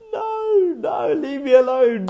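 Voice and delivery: high-pitched, drawn-out comic wail; mock panicked refusal. Man glued to his chair refusing, mock panicked cry. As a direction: cry it out high and slow, hammed-up. Acted frightened refusal of the offer.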